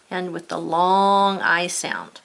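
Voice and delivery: "long" drawn out and leaned on, slow; slow down and hit "long"; stress on long. Shown as a demonstration. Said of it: "there's the key word".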